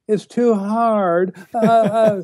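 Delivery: high, drawn-out mocking whine; exaggerated imitation of complainers; whining. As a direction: whine it like a sulky child, dragging the words. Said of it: "people start whining".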